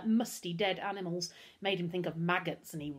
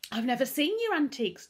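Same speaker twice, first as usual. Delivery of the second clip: put-on higher, louder boy's protest; 7 semitones higher, 6 dB louder than usual. A narrator's reading. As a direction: protest innocence as a scared boy. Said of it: "Darkus protested".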